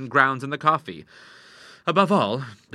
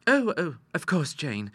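put-on calm young lady; 6 semitones higher, slower than usual. A narrator's reading. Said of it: "said the young lady calmly".

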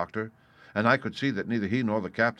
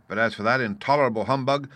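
squire's mild outburst, building higher; indignant cry; 4 semitones higher, 7 dB louder than usual. A narrator's reading. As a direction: denounce him, hitting each insult harder. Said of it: "cried the squire".

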